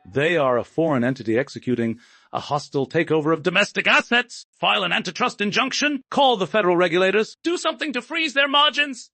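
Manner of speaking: high, loud, desperate shouting; frantic roar. A narrator's reading. Cornered tycoon shouting commands at his attorneys, frantic roar. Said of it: "Julian roared".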